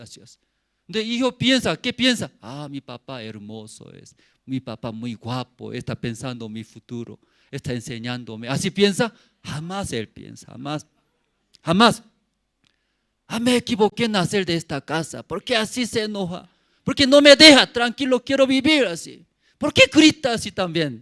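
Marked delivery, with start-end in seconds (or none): 2.4-8.5 low, soft dreamy praise; mock admiration. Mock admiring son's imagined thoughts. 13.3-21.0 raised, louder acted grumbling; son's indignant complaint. Son complains about angry father.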